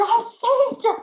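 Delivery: imitating a husband repeating his joy, high and loud; excited. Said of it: "He was so excited".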